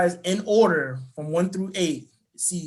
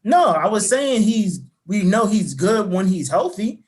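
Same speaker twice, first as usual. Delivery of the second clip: louder, pushing through interruptions; talking over; 4 semitones higher, 7 dB louder than usual. Real, not acted. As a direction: raise your voice to get a word in.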